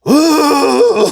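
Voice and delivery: high, loud, strained groan of someone about to vomit; retching groan. Retold or imitated. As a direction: let out a high retching moan, hammed up. Retching during ayahuasca purge.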